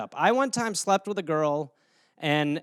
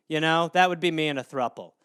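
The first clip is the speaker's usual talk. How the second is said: ordinary talk, nothing marked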